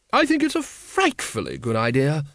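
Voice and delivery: emphatic, grand lady's approval; hearty aristocratic lady. A narrator's reading. Lady Ramkin backing the sergeant's idea, emphatically. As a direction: declare it with jolly conviction.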